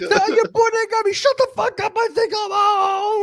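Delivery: very high, strained comic voice; hammed-up high-pitched outburst; mock panicked yelling. Acting out a gunpoint standoff, mock panicked yell.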